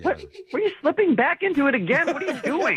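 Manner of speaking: high-pitched exasperated disbelief; incredulous. Real, not acted. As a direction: call it out, baffled and exasperated. Catches her faking the accent again.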